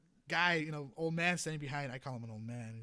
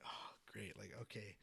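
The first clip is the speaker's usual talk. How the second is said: deflated; 6 semitones lower, 13 dB quieter than usual. Retold or imitated. Dejected after being scolded.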